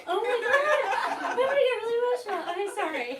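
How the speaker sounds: high, loud laughter through the words, overwhelmed; emotional laughing. Real, not acted. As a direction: laugh helplessly while trying to get the words out. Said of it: "I'm gonna get so emotional".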